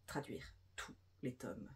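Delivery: normal talking voice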